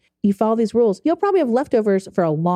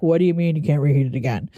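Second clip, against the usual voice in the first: lower-pitched mock protest; retold indignant retort; 5 semitones lower than usual. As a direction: act out your comeback to a scolding, pitched down. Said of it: "I'm like".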